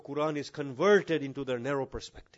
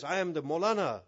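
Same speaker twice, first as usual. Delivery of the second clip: raised, indignant mock demand; hammed-up pompous complaint; acted self-importance; 5 semitones higher, 5 dB louder than usual. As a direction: imitate an entitled cleric insisting on privilege. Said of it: "He just had a frown on his face".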